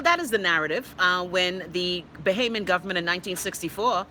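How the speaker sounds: ordinary delivery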